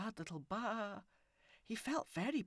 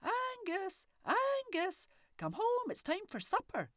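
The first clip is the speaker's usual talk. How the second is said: high, loud call for the goat; husband calls the goat home for supper, high and loud; 8 dB louder, slower than usual. A narrator's reading. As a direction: call him home across the field. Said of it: "he called".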